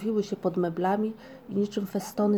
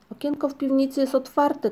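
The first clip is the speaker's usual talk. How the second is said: higher, louder excited call; shouted; 6 semitones higher, 4 dB louder than usual. A narrator's reading. Sniff finds an open cellar window, excited shout. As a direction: call out the discovery. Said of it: "krzyknął".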